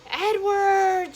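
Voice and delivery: long, high, distant call; shouted. A narrator's reading. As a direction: shout the name out, stretching it. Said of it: "she shouted".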